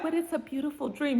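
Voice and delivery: high, soft, dreamy mimicry; dreamy girlfriend. Girlfriend answering his house plans, imitated dreamy softness. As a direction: coo it high and softly, lightly mocking.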